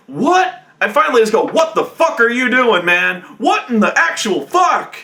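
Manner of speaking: high, loud disbelieving outburst; appalled yell. Retold or imitated. GM yelling at the troll player, loud disbelief. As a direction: yell at him like he's lost it.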